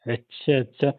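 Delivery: ordinary talk, nothing marked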